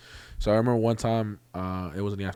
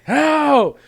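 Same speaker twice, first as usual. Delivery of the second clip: loud high yell, re-enacted; storyteller's full-voiced call for rescue; retold scream for help; 14 dB louder than usual. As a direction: cry out for help, much higher and louder. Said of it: "So I started screaming".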